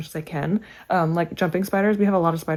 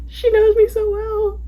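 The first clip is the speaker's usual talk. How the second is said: much higher, slow, laughing and teary; laughing through tears; slower than usual. Real, not acted. Touched by a friend's gift, laughing through tears. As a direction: laugh and tear up at once.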